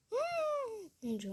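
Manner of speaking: high, slow astonished exclamation; drawn-out oh my God. Real, not acted. Player reacts to the shower in disbelief, high and slow. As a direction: stretch it out in disbelief.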